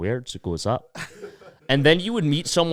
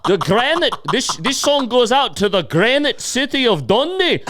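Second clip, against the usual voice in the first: high, loud hammed-up Scottish busker; mock Scottish accent; 13 semitones higher, 5 dB louder than usual. Mocking the Dundee piper, high loud Scottish accent. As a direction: plug the granite city like a proud piper, Scottish. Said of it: "Did you do that accent".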